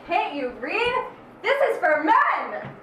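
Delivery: loud, slow indignant shout; angry yell. Acted. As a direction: bark it at the intruder. Yelling at bathroom intruder.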